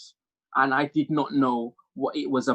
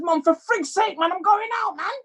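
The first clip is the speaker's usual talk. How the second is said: high-pitched whine of a son; acted exasperated pleading; exasperated protest. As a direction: protest to your mum, voice way up.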